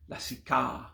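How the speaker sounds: LASIKA ending on a long, open AH; fully pronounced "ah" closing lasika; long final A. Shown as a demonstration. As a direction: draw out the last A of lasika. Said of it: "the long pronounced A".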